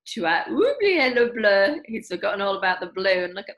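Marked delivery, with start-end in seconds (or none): 0.1-1.8 mother's reminder. Acted. Mummy points out missing blue.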